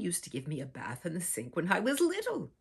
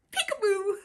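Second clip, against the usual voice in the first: high bright peekaboo call, louder; playful peekaboo; 6 dB louder than usual. Acted. Grandma playing peekaboo with the child, high and playful. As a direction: sing out peekaboo to a small child.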